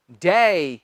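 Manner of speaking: डे as a low, falling, drawn-out day glide; lengthened DAY. Shown as a demonstration. Teacher corrects students' short डे, low long gliding day. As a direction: stretch डे into daay. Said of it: "डे नहीं, एकदम से मत कहना".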